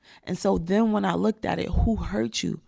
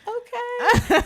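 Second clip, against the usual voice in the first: very high, laughing squeal of agreement; giddy compliance; 5 dB louder than usual. Acted. She answers her imagined soul partner, giddily submissive. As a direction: give in with a loud, girlish giggle.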